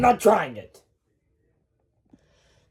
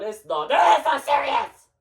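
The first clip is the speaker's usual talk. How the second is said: high, agitated shout; worked-up, shouted retort; heated shouting; 5 semitones higher than usual. Acted. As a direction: burst out in a high voice. Acted quarrel over shoe size.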